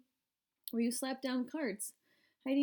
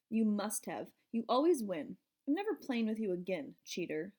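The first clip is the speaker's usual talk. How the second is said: ordinary delivery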